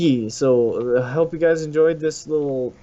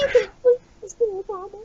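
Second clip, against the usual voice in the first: very high, pleading squeak; meme voice; faster than usual. Retold or imitated. Meme creature begging Mister Obama, very high and pleading. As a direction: squeak out a desperate plea. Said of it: "It's like".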